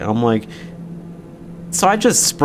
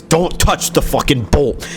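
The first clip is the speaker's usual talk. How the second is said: a mock angry snap, a bit higher and far from own voice; imitating vicious snap; 5 semitones higher than usual. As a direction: retell his vicious outburst, half-acted. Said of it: "And he went vicious".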